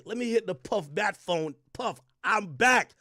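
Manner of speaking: slow, drawn-out put-on pleading; imitating Gina. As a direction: stretch each word out, mimicking a woman wheedling. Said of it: "Yo, she's like this".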